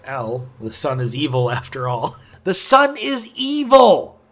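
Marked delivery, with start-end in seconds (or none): 2.4-4.2 high, loud, drawn-out mock cry; playful over-the-top outcry, pitched way up; mock proclamation. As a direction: proclaim it with hammed-up drama, slow and high. Mock proclamation, repeats then laughs.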